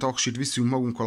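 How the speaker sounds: ordinary talk, nothing marked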